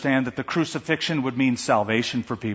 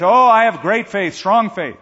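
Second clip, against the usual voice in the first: higher, louder, desperate assurance; eager overclaiming; 5 semitones higher, 6 dB louder than usual. Acted. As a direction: oversell your faith to save yourself. Man eagerly claims faith.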